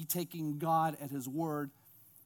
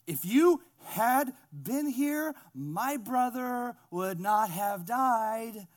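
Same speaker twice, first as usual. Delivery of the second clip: slow, higher-pitched acted complaint; drawn-out reproach; 4 semitones higher, 5 dB louder, much slower than usual. Martha to Jesus about her dead brother, drawn-out reproach. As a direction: stretch each phrase out as a pointed lament.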